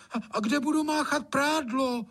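very high-pitched maid snapping back; high, stammered protest; indignant outburst. A narrator's reading. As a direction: burst in with an indignant objection. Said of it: "vyjela Anče".

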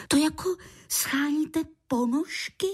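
put-on high-pitched child's question; puzzled child. A narrator's reading.

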